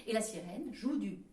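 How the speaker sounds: usual speaking voice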